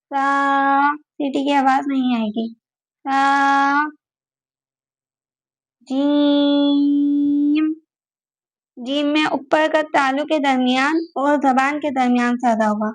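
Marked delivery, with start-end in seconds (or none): 5.8-7.7 جیم with the vowel held very long, Quran recitation style; جیم sung out long and even; stretched jeem. Shown as a demonstration. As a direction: draw out جیم for five alif counts. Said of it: "یہاں پر ہم پانچ الف کھینچیں گے".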